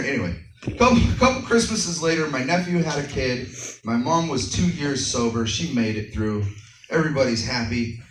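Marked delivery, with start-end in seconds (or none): none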